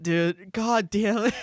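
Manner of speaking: ordinary talk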